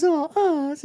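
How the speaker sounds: swooning. Retold or imitated. Girl sighing his name.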